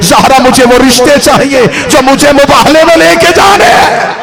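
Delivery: very loud, impassioned sermon delivery; sustained shout rising to a climax; full-force preaching. Acted. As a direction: thunder it out to the congregation.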